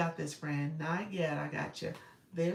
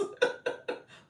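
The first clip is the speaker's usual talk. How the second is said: breathy burst of laughter; 5 dB louder than usual. Real, not acted.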